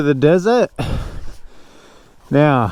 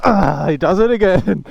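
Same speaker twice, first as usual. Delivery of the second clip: ordinary delivery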